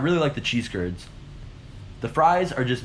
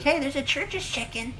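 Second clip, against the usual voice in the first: high-pitched put-on impression; Veda's voice; 12 semitones higher, 4 dB quieter than usual. Relative spotting a restaurant on her phone, imitated high voice. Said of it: "That's how she talks".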